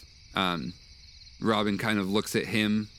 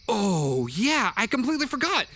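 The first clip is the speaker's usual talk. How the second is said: put-on much higher, childlike voice; young boy voice; 12 semitones higher than usual. Boy wizard remembers the scroll, high childlike voice. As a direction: jump up high like a forgetful kid.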